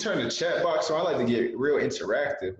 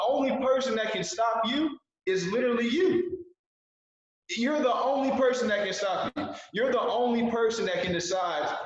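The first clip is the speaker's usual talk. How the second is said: emphatic motivational shout; 7 semitones higher than usual. Real, not acted. Motivational peak of the pitch.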